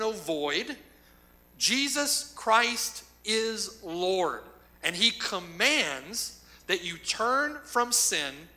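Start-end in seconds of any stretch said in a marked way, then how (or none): 1.6-8.5 very slow, every word weighted; slow proclamation. Real, not acted. Preacher proclaiming to the congregation that Jesus Christ is Lord, very slow. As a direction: proclaim Christ's lordship slowly.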